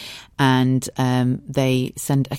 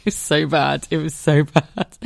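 laughing recollection. Real, not acted. Laughing at her own outburst.

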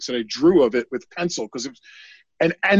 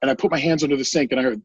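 ordinary talk

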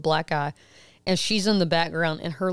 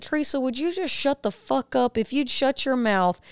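higher, cutting mock scolding; angry retold rebuke; 7 semitones higher than usual. Mother retelling snapping at Teresa, higher and cutting. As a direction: tell her off hard.

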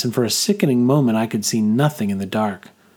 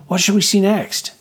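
put-on eager girl; 9 semitones higher than usual. A narrator's reading. Girl narrator voicing herself.

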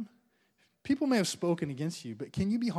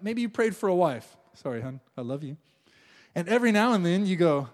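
normal talking voice

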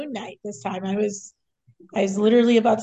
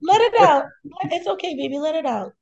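mock comforting; 10 semitones higher, 4 dB louder than usual. Mock consoling grieving friend.

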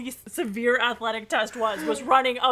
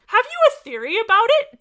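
high, loud, fast question; eager question; 11 semitones higher, 10 dB louder, faster than usual. Acted. Eager question read aloud.

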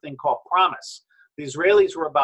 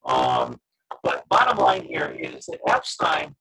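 glitchy robotic call distortion; garbled digital robot voice. Real, not acted. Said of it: "They just turned you into a robot".

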